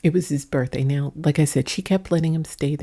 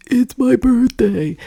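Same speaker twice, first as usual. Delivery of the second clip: put-on much higher and louder, slow drunken insisting; drunk yell; 8 semitones higher, 8 dB louder, slower than usual. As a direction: belt it like a sloppy drunk. Drunk neighbor insisting to husband.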